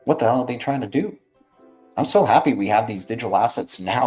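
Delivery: ordinary delivery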